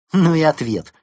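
loud, high dismissive retort; scornful snort. A narrator's reading. Fox mocking the penguin's vague answer, snorting. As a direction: scoff at the reply. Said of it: "фыркнула Лисичка".

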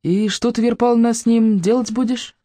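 plain reading voice

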